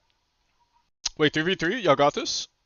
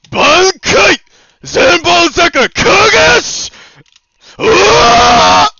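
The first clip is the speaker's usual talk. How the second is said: high, loud battle cry; anime attack shout; 12 semitones higher, 6 dB louder, much slower than usual. Pretend. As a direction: scream the move name like anime. Calling out ultimate move.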